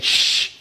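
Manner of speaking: long hissed shush. Acted. Everyone silencing Dave, shushing. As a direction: hush them with a drawn-out shh. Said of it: "so everyone said".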